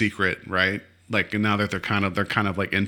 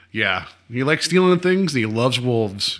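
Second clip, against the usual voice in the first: normal talking voice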